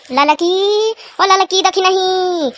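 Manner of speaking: slow drawn-out summons; calling out. Acted. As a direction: summon her across the house. Calling for the other girl.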